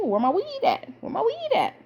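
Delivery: very high, mock-needy question; playful demand. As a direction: sing it out high, pretending to hunt for it. Playfully voicing herself hunting weed.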